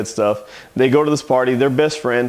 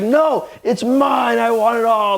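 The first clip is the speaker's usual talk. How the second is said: high, drawn-out mock tantrum; spoiled little girl; 8 semitones higher than usual. Spoiled girl fighting over presents, high mock tantrum. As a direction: imitate a greedy child, pitched up and stretched.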